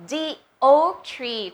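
THE as "dee", slow before oak tree; correct article sound. Shown as a demonstration. Teacher giving students the answer, correct article sound. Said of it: "ถูกต้องค่ะ".

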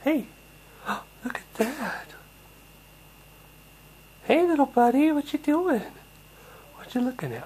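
put-on high, sing-song cooing to a baby; talking to a baby in a high sing-song voice. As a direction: coo up high at the little one.

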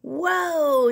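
loud rising "Whoa"; shout. Acted. Rancher shouting after the runaway cookie, loud call. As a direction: call out to stop him. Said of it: "shouted the rancher".

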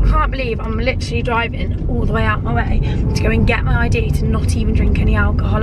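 usual speaking voice

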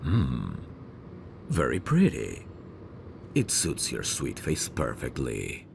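ordinary talk

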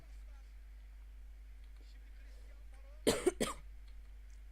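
sudden throat-clearing cough; a real cough fit. Real, not acted. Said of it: "Boğazıma bir şey takıldı".

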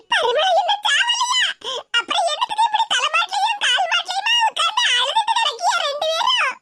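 shocked outcry. Acted. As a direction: shriek that she isn't dead yet. Granny shocked she's not dead. (அதிர்ச்சியான அலறல்)

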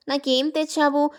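usual speaking voice

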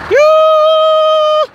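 high, loud shocked yoo; loud surprised yell. Real, not acted. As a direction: shout it in disbelief. Shocked exclamation at crash scene.

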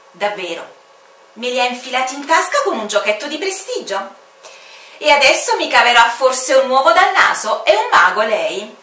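sarcastic incredulous official; mocking commander. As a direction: needle the stranger about magic tricks. Commander mocks the stranger.

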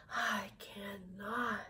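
put-on slow, exhausted whisper of an old engine; weary whisper. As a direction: breathe it out with nothing left. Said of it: "I'm making myself tired".

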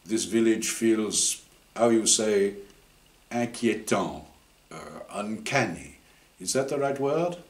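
put-on grizzled Frenchman with a French accent, unsure of his English; a French archaeologist warning in a French accent; light French accent, searching for the word. Voices French archaeologist throughout.

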